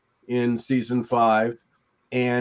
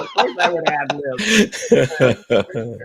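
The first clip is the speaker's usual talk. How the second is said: laughing; 3 dB louder, much slower than usual. Real, not acted. Laughing at his own joke.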